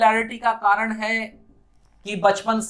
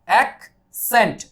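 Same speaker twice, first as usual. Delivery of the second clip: Accent slowly split as ak, pause, sent; in two parts; 6 dB louder, much slower than usual. Shown as a demonstration. Teacher showing the word's two parts, syllable by syllable. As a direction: break accent into two separate beats. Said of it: "दो भाग में ये बटा हुआ".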